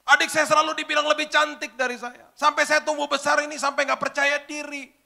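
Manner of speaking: imitating a sobbing young woman; crying complaint. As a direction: wail it out through tears. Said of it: "Sambil nangis-nangis".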